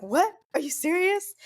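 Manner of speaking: husband's high-pitched, incredulous reaction; retold astonishment. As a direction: act out stunned disbelief, pitch shooting up.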